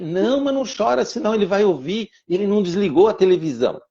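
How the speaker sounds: put-on a small boy gently soothing his sister; little boy comforting. Little son comforts crying sister. (menino pequeno consolando a irmã, voz aguda e suave)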